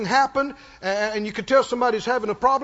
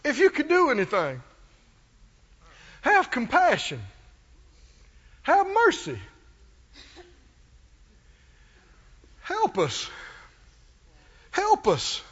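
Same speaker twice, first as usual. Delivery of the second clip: pleading father; 2 semitones higher than usual. Retold or imitated. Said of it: "Can you hear it?".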